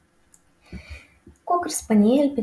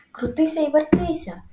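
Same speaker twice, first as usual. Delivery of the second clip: shouting goose; 7 semitones higher than usual. A narrator's reading. Goose telling Wilbur to dodge, shouting. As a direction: cry the order in a raised pitch. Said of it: "кричала гуска".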